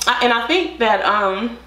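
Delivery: speaking through napkin; wiping mouth with napkin. Real, not acted. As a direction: hand covering mouth. Seen 1: Napkin held against mouth for the first second, then lowered.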